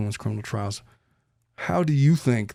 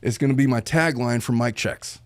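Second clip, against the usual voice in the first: ordinary talk, nothing marked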